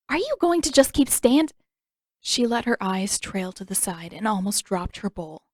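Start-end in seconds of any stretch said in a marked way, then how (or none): 0.1-1.5 impatient demand. A narrator's reading. Katara snaps at silent figure.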